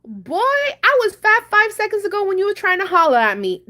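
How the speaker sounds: high, loud, indignant clapback; loud comeback. Retold or imitated. Woman answering the insulting guy at the gas station, loud. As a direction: clap back so the whole place hears. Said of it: "I said it louder than him".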